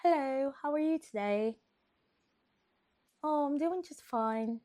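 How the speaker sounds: high-pitched fake English lady; mock English lady greeting; [name]. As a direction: put on a loud, high English accent. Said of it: "an English lady".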